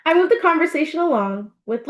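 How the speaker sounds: ordinary delivery, nothing marked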